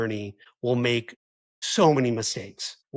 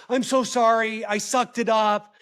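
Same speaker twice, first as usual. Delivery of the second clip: higher and louder, a hammed-up breezy sorry; mock careless apology, higher and louder; mock blanket apology; 8 semitones higher, 4 dB louder than usual. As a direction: toss off a hollow apology. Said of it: "don't just throw out a blanket apology".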